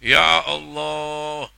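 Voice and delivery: slow, loud drawn-out call; spreading cry. Shown as a demonstration. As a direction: make it spread like a blast. (seruan keras yang menyebar)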